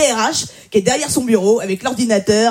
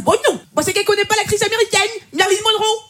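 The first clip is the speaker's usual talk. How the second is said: pitched up, fast neighbour's explanation; high mock neighbour; 9 semitones higher than usual. Neighbour explains the name, in a high quick voice. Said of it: "Elle me dit".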